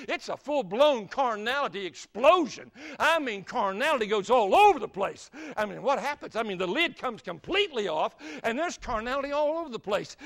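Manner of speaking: loud, punchy preacher's climax; full-force preaching. Real, not acted. Preacher warns his church about the carnality explosion, booming. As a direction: hammer the point home to the congregation.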